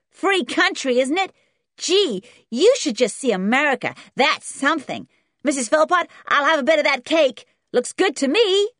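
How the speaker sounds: put-on high, loud, boastful kid; brash American boy. A narrator's reading. Boy asking Missus Philpot for cake, brash and loud. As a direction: brag and demand like a spoilt American boy.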